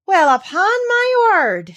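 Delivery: high, loud broad Yorkshire exclamation. Acted. Medlock overhearing the children, amazed, in broad Yorkshire. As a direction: Mrs Medlock gasps it in thick Yorkshire. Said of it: "speaking rather broad Yorkshire herself".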